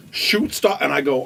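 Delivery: usual speaking voice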